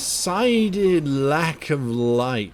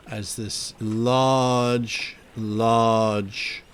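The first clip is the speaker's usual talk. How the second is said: drawn-out, low storytelling; slow dramatic narration; 4 semitones lower, slower than usual. A narrator's reading. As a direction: stretch each large slowly.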